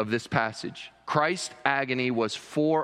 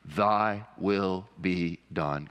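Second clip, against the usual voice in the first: reverent; 6 semitones lower, much slower than usual. Shown as a demonstration. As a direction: say it slowly to the face of God. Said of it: "looking full in the face of God".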